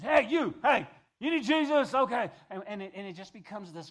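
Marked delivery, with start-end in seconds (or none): 0.0-2.3 much higher and louder dutiful yell; grudging shout. Retold or imitated. Believer sharing out of duty, loud mock-grudging yell. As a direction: bark it out just to get it done. Begrudging duty evangelism mocked.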